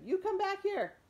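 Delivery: high, loud yell; shouting after. Acted. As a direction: chase the dog down with your voice. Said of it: "Ernest shouted".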